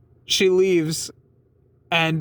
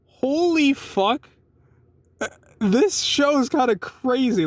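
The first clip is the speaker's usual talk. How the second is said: shocked realization; 8 semitones higher, slower than usual. Real, not acted.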